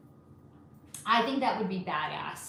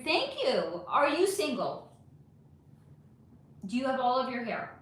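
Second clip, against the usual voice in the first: ordinary delivery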